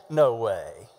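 low, drawn-down no way; lowercase no way. Shown as a demonstration. Preacher demonstrates typing without all caps, low-pitched. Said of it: "communicating something completely different".

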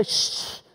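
unvoiced-breath "shh" of rebuke; long hush to silence someone; shushing. Embarrassed crowd hushing the blind beggar, rebuking shush. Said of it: "many rebukes him and say".

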